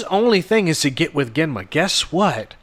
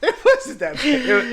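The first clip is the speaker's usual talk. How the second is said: laughing protest; 8 semitones higher than usual. Real, not acted. Defending joke while laughing.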